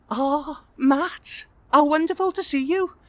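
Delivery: very high, delighted greeting; woman's voice. Acted. Old school crush greeting guest, delighted high voice. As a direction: put on a delighted high female voice.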